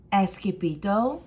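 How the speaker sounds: ordinary delivery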